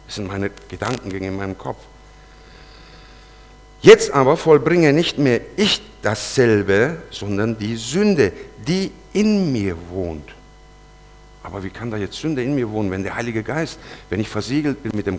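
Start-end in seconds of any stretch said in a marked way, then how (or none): 3.8-10.3 slow, weighty Bible reading; scripture reading. Acted. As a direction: read the verse slowly, every word weighing on you. Reading Romans verse. (langsames, schweres Bibelvorlesen)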